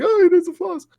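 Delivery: lightly mocking, high and excited; mocking fan voice. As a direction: parody a giddy fan. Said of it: "I know people are like".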